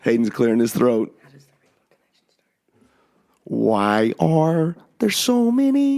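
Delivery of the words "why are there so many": slow, high sung Muppet impression; Kermit the Frog. Showing off Muppet voices on the podcast, sung Kermit impression. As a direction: croon it slowly in a higher frog puppet voice. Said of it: "Kermit the Frog, can you?".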